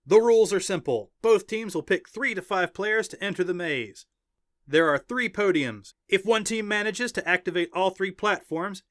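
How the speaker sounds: high, loud arena rule-reading; excited announcer. Acted. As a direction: proclaim the rules to the crowd. Announcer explains the rules.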